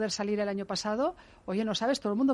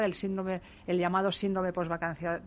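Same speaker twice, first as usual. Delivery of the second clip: ordinary talk, nothing marked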